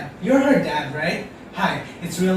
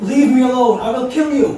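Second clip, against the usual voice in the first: loud, high-pitched threat; desperate shouting; 7 semitones higher, 7 dB louder than usual. Acted. Cornered suitor warns attackers away, desperate shouting. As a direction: yell to fend them off.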